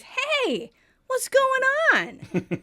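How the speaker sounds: high, slow, drawn-out question; mock alarmed. [name] demanding what is happening, high and slow. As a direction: stretch it in confusion.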